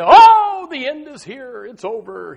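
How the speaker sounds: mock heartbreak wail. Heartbroken person after a breakup, hammed-up wail. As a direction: ham up a lovesick despair. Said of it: "one of those love situations".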